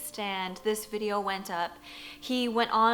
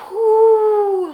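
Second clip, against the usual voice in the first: high, loud whoosh of him flying; whoosh sound; 9 semitones higher, 12 dB louder than usual. Retold or imitated. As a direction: swoosh him through the air. Tom flying through the air.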